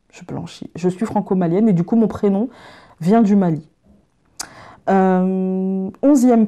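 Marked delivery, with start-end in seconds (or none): 0.1-0.7 much softer, an echo to herself; quiet aside. Real, not acted. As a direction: say it again under your breath. Repeats fact as amused aside. (« Je blanchis » redit tout bas, comme pour soi)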